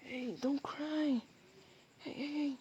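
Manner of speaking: high, slow, tender cooing; soothing comfort. Acted. Comforting a crying partner. (malambing na pag-alo, mataas ang tono)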